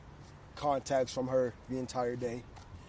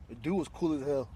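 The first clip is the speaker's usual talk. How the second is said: normal talking voice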